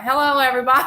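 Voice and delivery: loud greeting broken by laughter; laughing loud hello. Retold or imitated. Greeting the live chat with a laughing shout. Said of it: "I'm like".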